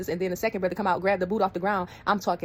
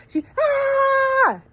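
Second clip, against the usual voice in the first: much higher piercing mock scream; imitated scream; 8 dB louder than usual. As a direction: scream like the charging cousin. Cousin charging with hot sauce.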